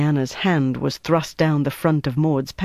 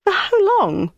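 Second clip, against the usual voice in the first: high, dismayed cry; aghast at the sentence; shocked, pitched-up dismay; 3 dB louder, slower than usual. Acted. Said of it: "Denise said in dismay".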